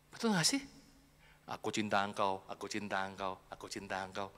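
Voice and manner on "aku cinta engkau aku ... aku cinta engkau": low, soft, monotone robot. Pretend. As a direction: repeat it like a machine. (suara robot datar dan lirih)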